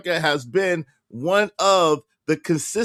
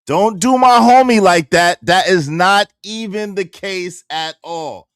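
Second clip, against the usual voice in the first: loud, punchy defense of his friend; emphatic repeat; 8 dB louder than usual. Retold or imitated. Streamer loudly repeating his defense of a friend, emphatic. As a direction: hit it hard, like you mean it.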